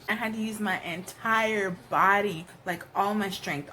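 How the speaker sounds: ordinary talk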